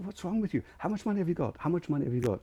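high-pitched imitation of an irate dad; mock cursing rant. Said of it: "he started up cursing".

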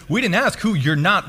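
ordinary delivery

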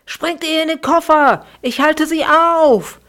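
high, loud breathless shout; gasping out of breath. A narrator's reading. Fax to his siblings while chased, high breathless shout. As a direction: yell the order while winded from running. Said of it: "japste Fax außer Atem".

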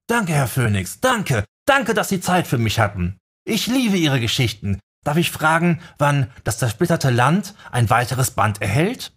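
begeistert. Acted. Begeisterter Fan dankt.

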